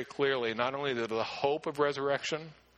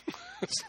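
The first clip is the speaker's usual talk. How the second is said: short embarrassed laugh; 5 dB quieter than usual. Real, not acted. Laughs at slide mix-up.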